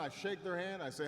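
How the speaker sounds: ordinary talk, nothing marked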